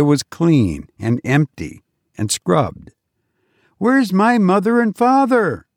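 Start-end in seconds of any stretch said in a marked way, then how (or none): 3.8-5.7 put-on high-pitched child demanding his parents; questioning boy. A narrator's reading. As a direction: ask high, like a worried boy.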